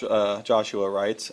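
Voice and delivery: normal talking voice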